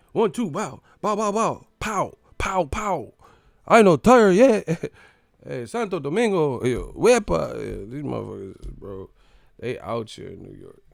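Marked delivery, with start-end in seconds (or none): 0.1-4.6 high punch noises bursting into a loud boast; mock Dominican fighter hyping himself up; hyped-up Dominican. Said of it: "That's how you should have been". 5.5-7.5 high, festive Dominican shout-out; mock Dominican fan calling out; Dominican hype. Acting out cheering Dominican fans.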